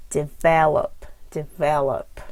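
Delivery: develop with VEL stretched; develop, emphatic middle syllable; stress on second syllable. Shown as a demonstration. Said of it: "again, for word stress".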